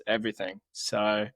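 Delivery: ordinary delivery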